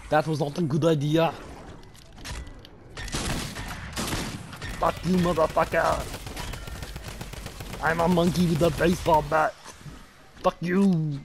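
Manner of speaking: put-on slow, menacing Russian accent. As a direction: gloat in a thick Russian accent. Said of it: "doing a Russian accent".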